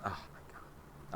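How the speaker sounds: soft, slow, breathless whisper; whispered disbelief. Real, not acted. Streamer watching the balloon, stunned whisper. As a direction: let it slip out under your breath.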